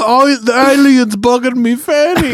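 shrieking cartoon Cockney complaint; mock Cockney. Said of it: "you're all Cockney".

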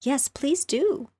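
high, slow pleading chorus; eager chorus. Acted. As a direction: beg together eagerly. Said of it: "joined in chorus".

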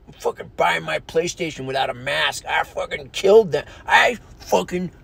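changed, ranting voice; mock angry shopper. Mocking maskless PlayStation buyer, ranting and changed. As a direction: brag like a belligerent shopper. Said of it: "Don't run into the mom and".

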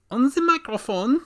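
mock sultry voice, playfully sultry; sultry narrator. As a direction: lean into a joking sultry purr. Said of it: "my sultry voice".

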